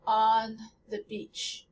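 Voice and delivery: "On" said slowly with the English vowel, lips unrounded, no nasal; slow English "On the beach" with an open, unrounded vowel. Shown as a demonstration. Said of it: "So if I say on in English".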